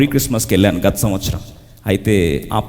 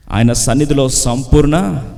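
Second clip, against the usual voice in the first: usual speaking voice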